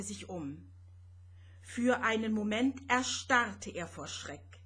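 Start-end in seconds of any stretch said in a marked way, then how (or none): none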